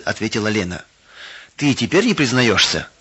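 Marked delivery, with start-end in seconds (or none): none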